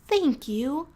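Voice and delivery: high, loud, slow grateful cry; exclaimed. A narrator's reading. Mouse thanking her rescuer, loud and grateful. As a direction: burst out in thanks. Sophie voice.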